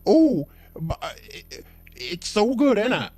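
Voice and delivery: hammed-up high yokel; yokel customer. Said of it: "And then he says".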